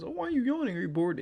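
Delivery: high mock-nagging commenter; mocking viewer voice. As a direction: whine it like a nosy viewer. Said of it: "before somebody says".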